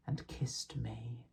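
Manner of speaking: tender whispered words; soft whispered aside. Acted. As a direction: whisper it like a kiss. Line whispered.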